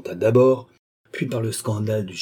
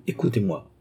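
calm command; 2 semitones lower than usual. A narrator's reading. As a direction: soothe him into listening. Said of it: "un geste qui commandait le calme".

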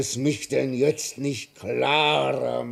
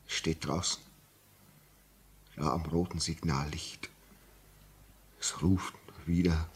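fearful whisper; 9 semitones lower, 10 dB quieter than usual. Acted. Signalman whispering to the doctor about the ghost, fearful whisper. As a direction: whisper it so the ghost won't hear.

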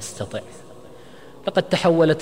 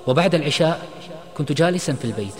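plain reading voice

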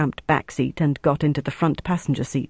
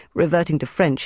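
ordinary delivery

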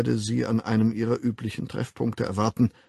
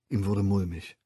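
usual speaking voice

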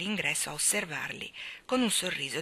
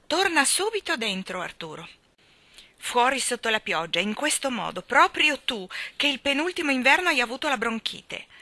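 louder, higher scold; 6 semitones higher, 5 dB louder than usual. Acted. Mary Poppins scolding her cousin for going out in rain. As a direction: tell him off like a nanny.

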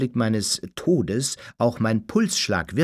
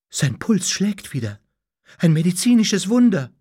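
astonished, climbing higher and louder; stunned disbelief; 8 semitones higher, 5 dB louder than usual. A narrator's reading. Doctor finds the dead man's pulse, stunned. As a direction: announce it dumbfounded, building to amazement. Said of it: "erklärte fassungslos".

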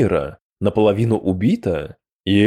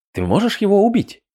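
ordinary delivery, nothing marked

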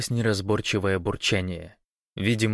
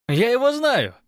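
very high, loud yell; high-pitched cry of recognition; excited shout; 6 dB louder than usual. A narrator's reading. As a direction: shout the news up to comrades. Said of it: "крикнул он наверх".

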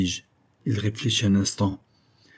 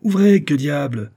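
impatient cry, higher and louder; exclaiming; 6 semitones higher, 5 dB louder than usual. A narrator's reading. Visitor demands his friend open the door, crying out impatiently. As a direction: call out to be let in. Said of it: "m'écriai-je".

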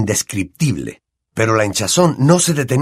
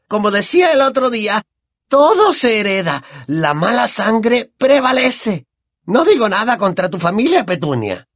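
high, brash, boozy aunt voice; Aunt Marge; 11 semitones higher than usual. Acted. Aunt sneers at the family over brandy, high brash voice.

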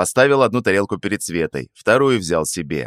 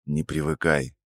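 lower, softer grumble; mutter; 4 semitones lower, 6 dB quieter than usual. A narrator's reading. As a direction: grumble under your breath. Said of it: "буркнул я себе под нос".

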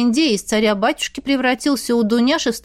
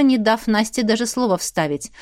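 plain reading voice

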